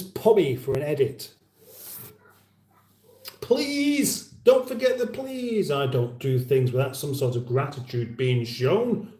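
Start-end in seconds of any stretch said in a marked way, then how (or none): 3.4-5.7 high, sing-out reminder; mock prompting. Host prods viewers to say please, mock prompting. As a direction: remind them in a much higher voice.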